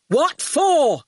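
high, suspicious shout; angry shout. A narrator's reading. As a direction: shout back a challenge. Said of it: "shouted Archer".

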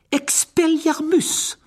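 high, slow, loud incantation; spell cast. Acted. Harry disarming Malfoy, high slow loud incantation. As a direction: fire off the spell.

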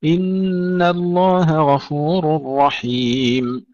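slow, measured tajweed recitation with long held vowels; Quran recitation. Acted. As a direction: recite slowly, drawing out the elongations and nasal sounds. Recites verses before teaching them. (تلاوة مرتلة بطيئة بأحكام التجويد)

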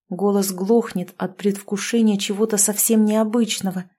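ordinary delivery, nothing marked

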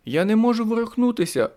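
terrified woman calling out; panicked cry. Acted. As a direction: cry out, trapped. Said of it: "гукнула вона".

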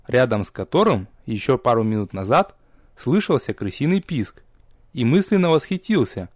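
usual speaking voice

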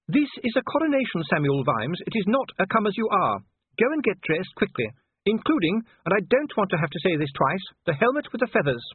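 high, firm, scolding voice; stern wife. A narrator's reading. Sybil orders Vimes to dress for the coronation, stern. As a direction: lay down the law to a husband.

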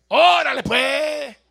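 put-on northern Mexican accent. Imitating how people from northern Mexico talk, loud northern Mexican accent. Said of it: "cómo hablan la gente del norte".

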